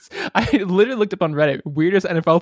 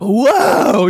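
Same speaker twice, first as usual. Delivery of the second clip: excited whoa; 6 semitones higher, 5 dB louder, much slower than usual. Real, not acted. Host announces correct answer.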